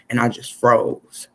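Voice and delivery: ordinary delivery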